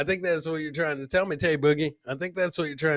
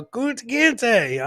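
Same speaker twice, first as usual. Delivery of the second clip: mock yell; 8 semitones higher, 4 dB louder, much slower than usual. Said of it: "he did yell".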